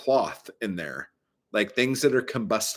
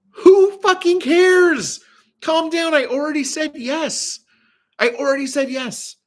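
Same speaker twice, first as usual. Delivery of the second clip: exasperated retort; 7 dB louder than usual. Retold or imitated. Snapping back at the worker over rags, acted exasperation. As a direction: start loud and stretched, then keep it high and pleading.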